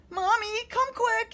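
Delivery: high loud call. A narrator's reading. Monster calls mommy after seeing fingers, high and loud. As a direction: shout it high and urgent. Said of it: "he called".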